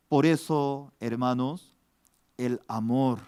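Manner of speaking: usual speaking voice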